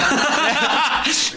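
high delighted cackle at his own prank; burst of laughter. Real, not acted. Guy recalling beating his little cousin, laughing.

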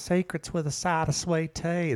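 put-on a lightly done Southern belle accent. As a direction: slip back into a Southern belle voice. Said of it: "You got it back".

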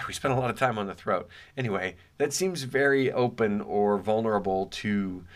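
ordinary talk

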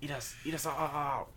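high little pill-bottle voice, begging; talking pill bottles. Acted. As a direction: plead in a high, put-on creature voice. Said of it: "the pill bottles were talking to her".

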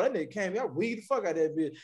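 ordinary talk, nothing marked

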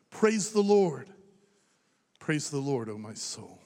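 swelling declamation, then a hushed close; hymn recited with fervour. Acted.

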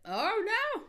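high, loud hammed-up alarm; mock panic. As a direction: cry out in pretend panic. Pretend panic about demons.

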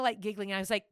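ordinary delivery

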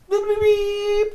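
high, slow mock bleeps; censor bleep. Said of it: "Bleep that".

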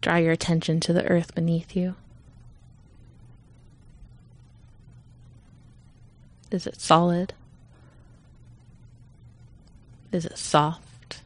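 calm, unhurried meditation voice with long pauses; guided meditation. Real, not acted. Leader guiding listeners to settle in, calm meditation voice. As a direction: ease listeners gently into stillness.

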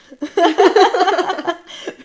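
big laugh bursting out; loud burst of laughter. Real, not acted. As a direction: laugh out loud, high and hard. Laughing at hotel basement joke.

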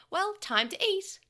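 put-on high, loud and slow boy voice; eager boy. Boy ignoring his friend, high and slow. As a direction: announce it loudly to spite Tokomon. Takeru defiantly ignoring Tokomon.

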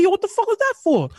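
high startled protest after a slap; indignant yelp. Retold or imitated. Slapped by his mother, high indignant protest. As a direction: yelp it out, caught off guard.

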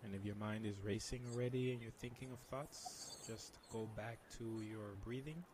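soft spa whisper. Shown as a demonstration. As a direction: guide them into calm, very softly. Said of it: "can you lead us through a meditation".

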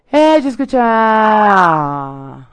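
very slow, long-held calling out; drawn-out call. Real, not acted. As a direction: stretch the last sound out at length. Calling out as she receives message.

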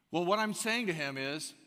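ordinary talk

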